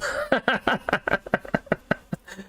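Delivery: long run of chuckling bursts; long laugh. Real, not acted. Streamer laughing over a Gaelic word, long laugh. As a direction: crack up and keep laughing.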